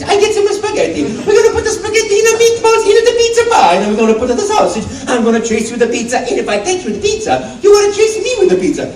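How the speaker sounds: very high, bouncy Italian cartoon voice; Mario. Improvising an audition to camera, high cheery Italian character. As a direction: squeal it out with playful Italian gusto. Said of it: "just make that younger".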